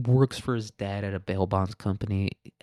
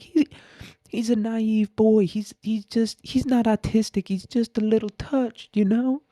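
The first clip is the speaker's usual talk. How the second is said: worried mother; 12 semitones higher, 3 dB louder than usual. Acted. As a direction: plead in a high put-on voice for your boy. Mimics the man's pleading mother.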